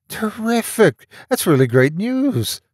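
high, drawn-out exclamation of delight. A narrator's reading. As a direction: celebrate the news, pitched right up. Mark delighted by good news.

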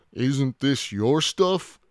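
slow questioning dinosaur voice; puzzled dinosaur. A narrator's reading. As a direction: ask it in drawn-out puzzlement. Stegosaurus asks, puzzled.

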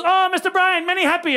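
high, gleeful mimicked driver; Mister Mamba. Driver greets his very stoned passenger, gleeful driver voice.

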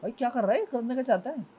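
high, startled outcry; alarmed. Real, not acted. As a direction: react in alarm to the threat. Spider approaching again.

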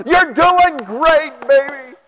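slow, high, syrupy praise; a mock-tender wife cheering her husband; sweet praise. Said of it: "He's out there blowing the snow".

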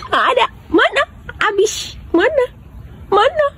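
put-on playful baby talk. Peekaboo game with baby.

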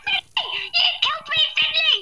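high squeaky mock whine, laughing; silly high-pitched imitation of a friend; mocking whiny friend voice. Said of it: "Please stop the voices".

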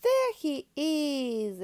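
slow, loud, high cry of discovery; excited exclaim. Acted. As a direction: call it out thrilled to spot him. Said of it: "exclaimed Patty".

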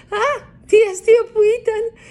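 high, loud laughing-joy cry; a mouse thrilled after the jump; delighted shout. A narrator's reading. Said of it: "φωνάζει".

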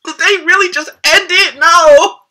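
high-pitched disbelief rising to a wail; mock despair. Fan learning they really ended it, mock despair.

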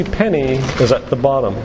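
usual speaking voice

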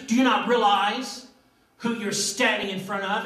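loud, high, indignant Roman governor; arrogant Pilate. Acted. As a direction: bellow it like an offended ruler. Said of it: "I want you to hear it like I believe he said it".

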